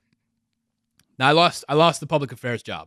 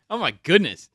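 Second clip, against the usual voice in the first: normal talking voice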